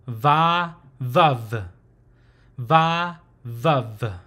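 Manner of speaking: "va, vuv" with a buzzing V; V consonant. Shown as a demonstration. Teacher presenting consonant number nineteen, demonstrated V pronunciation. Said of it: "this consonant is pronounced".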